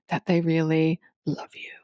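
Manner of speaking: sexy whisper. Pretend. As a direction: breathe it like a seduction. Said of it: "a sexy podcast voice".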